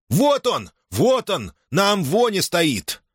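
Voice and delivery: высокий выкрик из толпы; крикни высоко, указывая толпе на беглеца; возбуждённый выкрик. Acted. Толпа заметила беглеца в храме. (возбуждённо, выкриком)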